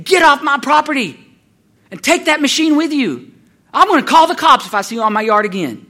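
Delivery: loud, high, threatening yell; outraged mock shouting; screaming old lady. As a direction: imitate an old woman ordering someone off her land. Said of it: "screaming at you, threatening to call the cops".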